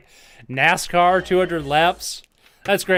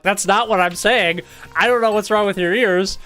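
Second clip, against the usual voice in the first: loud, raised-pitch mock arguing; exasperated retort; 6 semitones higher, 7 dB louder than usual. Streamer replaying his argument with listeners, hammed-up exasperation. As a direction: act out losing patience in a quarrel. Retold quarrel over pronunciation.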